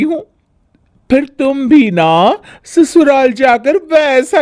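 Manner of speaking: high, show-off promise; bragging bahu. Acted. Bhabhi bragging to Sonam about her cooking, proud. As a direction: boast sweetly.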